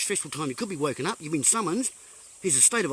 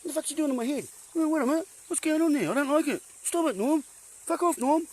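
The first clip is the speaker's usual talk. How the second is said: higher-pitched mock confusion about Norm; imitating a confused viewer; 8 semitones higher, faster than usual. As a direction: act out a baffled viewer telling Norm to stop. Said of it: "you're thinking".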